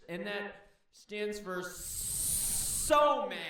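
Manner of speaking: high, loud cartoonish anger with drawn-out words; mad snake. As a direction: play a furious snake, hammed-up and high. Voices snake announcing it's mad.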